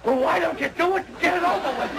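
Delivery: high, strained nervous complaint; panicky impatience. Acted. Patient to nurse before the injection, panicky and impatient. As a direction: plead to have it done, wound tight. Patient braced, waiting for the needle.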